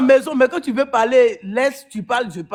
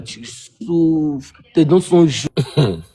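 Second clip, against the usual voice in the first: much lower, slow, dragged-out taunt; slow mocking drawl; 7 semitones lower, much slower than usual. Real, not acted. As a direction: drag out each word to mock her. Moquerie de la rivale. (lente moquerie grave)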